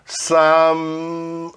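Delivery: Some said as "sam", no final E sound; some without the E. Shown as a demonstration. Teacher modelling Some, final E silent. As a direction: clip Some to one syllable, silent E. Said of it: "sem falar o E".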